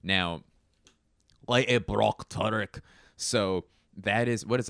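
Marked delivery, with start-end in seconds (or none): 1.5-2.8 Scottish with rolled R's; Scottish accent. Acted. Demonstrating Scottish rolled R's, Scottish accent. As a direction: roll the R's like a Scot. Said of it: "they kinda roll their R's".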